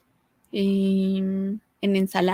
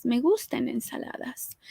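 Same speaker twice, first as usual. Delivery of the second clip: whisper; 6 dB quieter than usual. Real, not acted. Whispered aside about salads.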